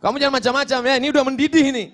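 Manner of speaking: high-pitched mock warning; acted anger. Angry person warning someone not to mess around, acted anger. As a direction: play someone starting to boil over. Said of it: "kalau sudah mulai marah, dia ngomong".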